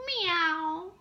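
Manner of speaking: high put-on meow; cat meow. George the dog answering his mother, high meow.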